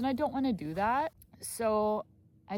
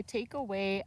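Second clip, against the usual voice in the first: normal talking voice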